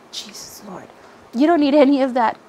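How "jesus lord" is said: soft unvoiced whisper of Jesus Lord; whispered aside. Real, not acted. Annoyed with herself after a slip, whispered. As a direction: breathe it under your breath, embarrassed.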